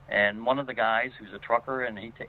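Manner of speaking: ordinary talk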